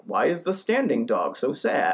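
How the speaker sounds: lightly voiced dog wondering aloud; puzzled dog. Dog to departing walker, puzzled. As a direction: innocent questioning lilt of a confused pet. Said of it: "looks at me like".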